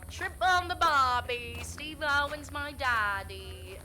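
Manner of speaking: over-the-top mock Australian accent; terrible Australian accent. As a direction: ham up a bad Aussie accent, drawn out slowly. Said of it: "that one was probably a terrible Australian one".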